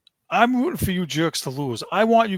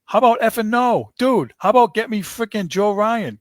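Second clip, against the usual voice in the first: loud, higher retort to the TV pundit; mock angry outburst; 5 semitones higher, 5 dB louder than usual. Fan answering the trade deadline analyst with a loud, angry retort. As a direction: snap back at him louder and higher. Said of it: "I'm like".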